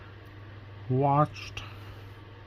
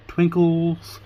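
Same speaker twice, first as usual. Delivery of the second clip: higher and louder, a playful blurt; silly exclamation; 6 semitones higher, 7 dB louder than usual. Real, not acted. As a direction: toss out the word like a cheer.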